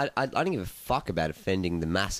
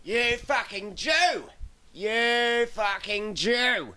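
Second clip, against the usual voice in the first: high, slow, drawn-out yell at the comic; drunken heckle scream; 13 semitones higher, much slower than usual. Retold or imitated. Drunk heckler screaming at a bad comic, high drawn-out yell. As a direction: hurl it from the crowd like a wasted heckler. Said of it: "and I scream out".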